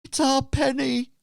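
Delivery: loud, high anguished cry; distraught innkeeper. Acted. Darth telling his wife it's their Penny, anguished. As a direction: cry it out as the grieving father. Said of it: "turns around to Wifey and just says".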